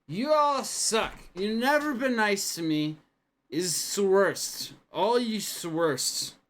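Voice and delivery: put-on high, loud, slow drunken whine; whiny drunk. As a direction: sulk at them like a drunk. Drunk man sulking loudly.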